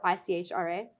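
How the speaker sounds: I C H R A as separate letter names; spelled out. Shown as a demonstration. Host listing another way people say it, I C H R A spelled out. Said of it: "some people pr-, pronounce it".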